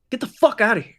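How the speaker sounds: high, loud gunman shout; angry dismissal. Acted. Gunman pointing gun at intruder, angry dismissal. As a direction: order them out forcefully.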